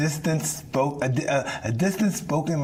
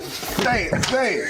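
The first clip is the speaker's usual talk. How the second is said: taunting; 9 semitones higher, slower than usual. Acted. Taunting again.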